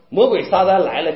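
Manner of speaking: ordinary delivery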